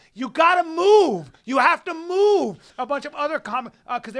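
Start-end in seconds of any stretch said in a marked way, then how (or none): 0.1-2.6 high, slow, loud shout; photographers' order. Retold or imitated. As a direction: holler it at someone blocking the shot. Retold photographers ordering him away.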